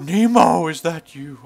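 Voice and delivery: attempted movie dad voice, loud on the name; Albert Brooks impression. Player attempts the clownfish dad for the child, failed impression. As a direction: call out loudly for Nemo, half-hearted impression. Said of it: "can't do an Albert Brooks impression".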